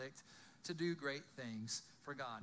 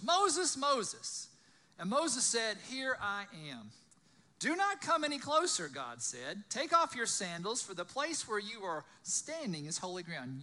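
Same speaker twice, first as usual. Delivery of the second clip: loud, higher-pitched storytelling voice; dramatic scripture reading; 4 semitones higher, 6 dB louder than usual. A narrator's reading. Preacher reading the burning bush story aloud, loud and dramatic. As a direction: read the Bible story out to a crowd.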